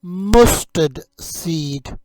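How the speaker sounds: very slow "mustard seed." for copying; slow teaching model. Shown as a demonstration. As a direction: draw out "mustard seed." clearly. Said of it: "You say it".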